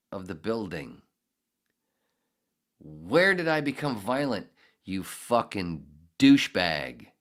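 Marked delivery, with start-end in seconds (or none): 2.8-7.1 loud, angry, slowing on the insult; a real furious yell at the people who accused him; furious outburst. Real, not acted. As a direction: spit the insult out slowly. Ranting at school staff.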